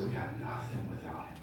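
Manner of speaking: hushed, breathy-free whisper of surrender; soft whispered humility; whispered confession. Acted.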